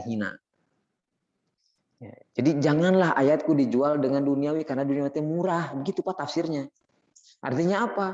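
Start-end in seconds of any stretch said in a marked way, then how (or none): none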